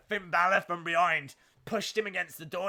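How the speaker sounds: ordinary talk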